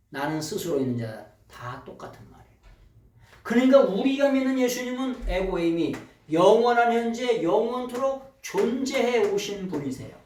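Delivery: ordinary talk, nothing marked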